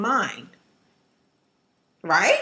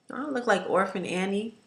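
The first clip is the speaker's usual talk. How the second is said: normal talking voice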